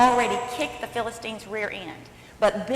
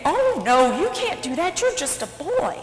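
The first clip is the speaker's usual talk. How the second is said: high, protesting royal voice; dismissive king; 6 semitones higher, 5 dB louder than usual. Acted. King tells David he is just a boy, high protest. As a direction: object from the top of the voice.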